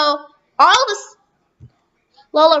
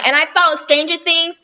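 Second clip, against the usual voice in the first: ordinary talk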